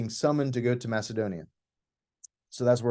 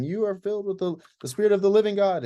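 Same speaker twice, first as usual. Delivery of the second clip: higher-pitched mock heckling; imitated calling out; 8 semitones higher, 4 dB louder than usual. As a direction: imitate a pestering woman calling out. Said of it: "calling out".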